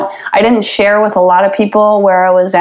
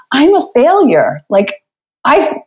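higher, slowed, mock-tearful lament; replaying her tearful moment, half-mocking it; retold tearful cry; 7 semitones higher, slower than usual. She tells her husband through tears she is a failure.

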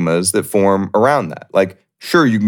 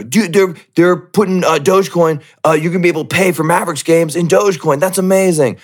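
much higher, breathless-hype parody; mock excited fan; 9 semitones higher than usual. Mocking fan hyping Dogecoin payments for games, high excited gushing. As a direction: gush in amazement, sending up the fan.

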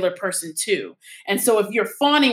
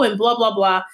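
ordinary talk

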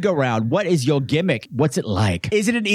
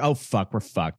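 dropping low and softer; mock dismay; 9 semitones lower, 6 dB quieter, slower than usual. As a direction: sink into mock doom. Mock realization nobody minds the store.

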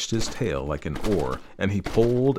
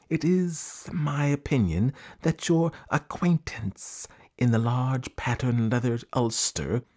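very slow, drawn-out pompous serpent; python rock snake; 3 semitones higher than usual. Acted. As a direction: stretch the long words out with ceremony. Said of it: "This is the way bi-colored python rock snakes always talk".

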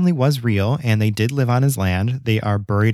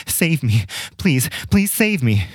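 higher-pitched narrated plea for help; understated storybook cry for rescue; mild pleading shout; 4 semitones higher, slower than usual. A narrator's reading. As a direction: voice a desperate cry, held back in volume. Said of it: "wildly out of breath, shouting".

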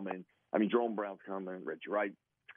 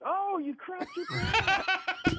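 high, slow, laughing mock shock; laughing quote; 4 dB louder, much slower than usual. Teammate Tunch discovers the accident, slow high laughing disbelief. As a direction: draw it out through laughter.

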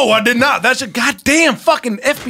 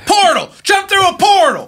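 excited shout; 7 semitones higher than usual. Acted. Calling out to jump through a portal, excited shout. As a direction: shout it like an urgent call.

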